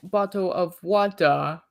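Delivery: mock British accent. Joking with the co-host, a hammed-up British accent. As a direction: stretch bottle of water in a joking British accent. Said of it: "I can't do a British accent".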